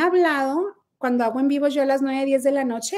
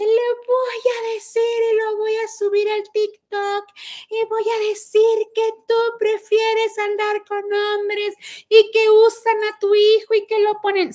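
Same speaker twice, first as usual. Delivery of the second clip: high, whiny mimicry of her threats; mocking imitation of the caller; 8 semitones higher than usual. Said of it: "y me dice". (imitando burlona a la señora)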